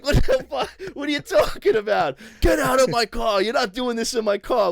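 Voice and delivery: high, loud mock outrage; hammed-up furious shout in the back seat; retold angry yelling. Retold shouting match in Uber.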